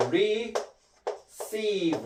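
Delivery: "receive" broken into two drawn-out syllables; syllable-by-syllable demonstration. Shown as a demonstration. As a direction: say "receive" slowly, re, then ceive. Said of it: "자, 발음은". ("receive"를 음절로 나눠 길게 발음)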